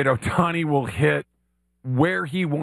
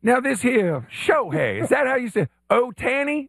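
Billy Payne; 7 semitones higher than usual. Acted. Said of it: "as Billy Payne?".

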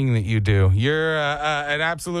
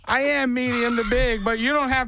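exasperated son; 6 semitones higher than usual. Retold or imitated. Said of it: "I was like".

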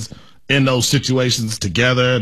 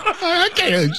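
laughing; 14 semitones higher than usual. Real, not acted. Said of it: "laughing at the phrase".

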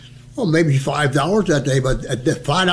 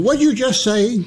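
sharp raised sergeant voice; high-pitched sergeant demand; 8 semitones higher than usual. Retold or imitated. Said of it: "He said".

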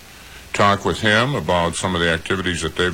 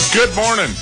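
cheery greeting; 5 dB louder than usual. Real, not acted. Morning radio show greeting.

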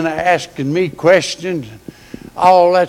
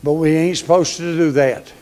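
normal talking voice

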